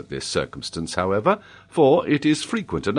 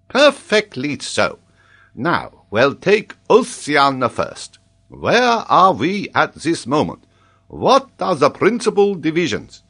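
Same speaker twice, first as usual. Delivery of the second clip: loud, high, drawn-out command; solemn professor; 8 semitones higher, 5 dB louder than usual. A narrator's reading. Geographer begins examining the boy, pompous professor voice. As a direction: pompously summon a pupil to his feet.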